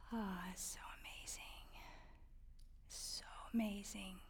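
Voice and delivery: hushed awe; awed whisper. Real, not acted. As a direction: whisper it like a precious secret. Awed about reading aloud.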